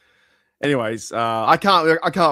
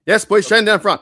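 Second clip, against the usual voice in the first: loud, high call across the room; raised classroom call; 7 semitones higher, 9 dB louder than usual. Real, not acted. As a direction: summon Shane forward like a teacher. Calling someone to the front.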